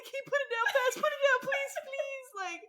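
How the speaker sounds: impaled victim begging Silver Taint; mock pleading. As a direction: beg for rescue in mock panic. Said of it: "And it's just like".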